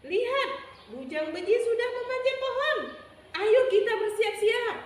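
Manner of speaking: high, loud storybook animal call; urgent alarm. Acted. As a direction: sound the alarm and rally everyone. Hewan berseru melihat musuh memanjat. (seruan siaga, tinggi dan lantang)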